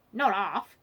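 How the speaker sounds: loud, abrupt reply; quick. A narrator's reading. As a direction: blurt it out loudly and quickly. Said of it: "rather quickly".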